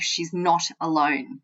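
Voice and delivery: usual speaking voice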